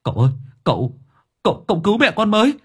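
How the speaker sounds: high, stammering call for help; panicked cry. Acted. Son calls the master to save his mother, panicked shouting. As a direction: build to a loud, high-pitched alarm. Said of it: "anh con trai hốt hoảng hô lên".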